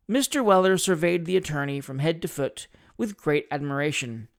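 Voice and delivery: ordinary delivery, nothing marked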